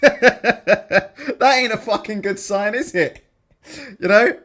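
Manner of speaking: slow words squeezed out through laughter; real giggling while talking; laughing. Real, not acted. Host laughing at Chisora's tweet with his chat, slowly, through laughter.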